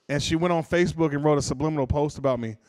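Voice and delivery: usual speaking voice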